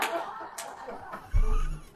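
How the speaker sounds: breathless high laughter; high breathy laugh. Real, not acted. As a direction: let the laugh burst out high. Laughing at own story.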